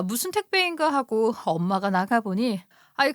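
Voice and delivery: ordinary delivery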